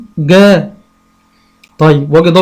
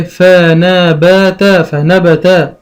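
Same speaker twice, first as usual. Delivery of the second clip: فنبت stretched out very slowly; very slow sounding out; much slower than usual. Shown as a demonstration. Teacher sounding a word out for a child, slowly. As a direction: draw فنبت out. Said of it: "يقول إيه".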